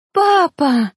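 high, loud cry for her father. A narrator's reading. Girl seeing her father, loud high cry. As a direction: call out to Dad with all she has. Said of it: "закричала Ория".

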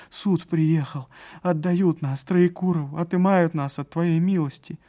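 put-on panting old servant, high and loud; breathless old speaker. A narrator's reading. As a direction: gasp it out, alarmed old man. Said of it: "задыхаясь".

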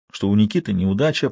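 usual speaking voice

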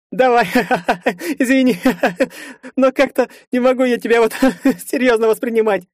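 high voice broken by laughter; can't stop giggling at him; laughing. Acted. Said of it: "Слегка посмеиваясь". (сквозь смех)

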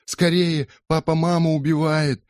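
high, loud frantic cry for help; frantic shout. Acted. Polly bursts in on the neighbours crying for help. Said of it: "кричала Полли".

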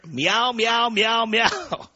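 high-pitched taunting cat meows; jeering imitation of a cat; mocking meows. Mocking Dean as pussy with meows.

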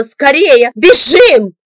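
loud urgent yell; woman dragging him, yelling to flee; shout. A narrator's reading. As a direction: scream at him to run. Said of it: "она закричала".

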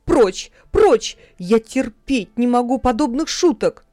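angry shout. A narrator's reading. Mother angrily chasing off the dwarf, loud. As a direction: drive him away angrily. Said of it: "сердито ответила Ханна".

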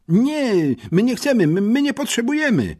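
high-pitched panicked protest; terrified. A narrator's reading. Schoolboys refusing a dentist visit, terrified. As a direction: stammer and plead in a high, scared voice. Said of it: "wyprowadziła przerażonych agentów".

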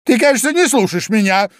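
high, loud, quick scolding; stern mouse. A narrator's reading. Mouse suddenly rebukes inattentive Alice, sternly. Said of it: "вдруг строго сказала мышь".

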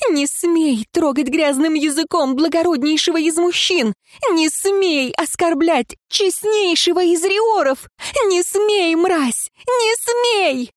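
very high furious screeching; визг ярости; shrieking. A narrator's reading. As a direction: screech at him in rage. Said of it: "визжала женщина".

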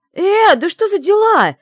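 high, loud, slow outraged cry; indignant exclaim. Acted. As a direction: shout it in sleepy outrage. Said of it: "возмущённо воскликнула я".

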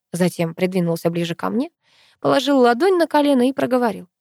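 ordinary delivery

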